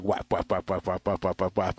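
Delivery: rapid run of low, soft whaps; repeated whap sounds, evenly rattled off; imitated smacking noises. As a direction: mimic a string of spatula smacks.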